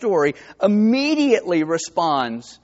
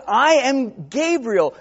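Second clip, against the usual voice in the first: slow, raised and solemn; the angel Gabriel; 6 semitones higher, slower than usual. Retold or imitated. Gabriel announcing himself to Zechariah, slow and solemn. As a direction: announce yourself as the angel to the old priest. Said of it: "He says".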